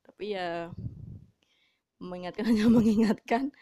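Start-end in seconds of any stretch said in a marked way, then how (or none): 2.0-3.5 laughing. Real, not acted. Laughing at mother's mild warnings.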